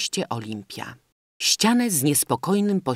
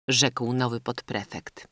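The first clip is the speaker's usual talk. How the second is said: usual speaking voice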